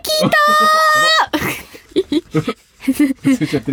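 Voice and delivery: hammed-up astonishment rising to a high squeal; feigned surprise. Host pretending the known news is new, hammed-up surprise. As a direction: play shocked delight, stretch the last word high. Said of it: "前から知ってたけど、".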